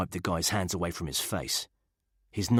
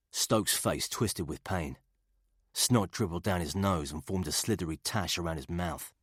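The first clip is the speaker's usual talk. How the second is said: usual speaking voice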